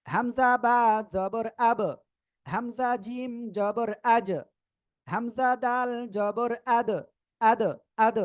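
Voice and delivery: slow held-pitch spelling, bouncing the end of আব, আজ, আদ; qalqalah spelling demo. Shown as a demonstration. Teacher spelling for learners, slow recitation with bounced আব, আজ, আদ. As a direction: spell slowly, then release the last consonant of আব, আজ, আদ with a push. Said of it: "ধাক্কা দিয়ে পড়ব".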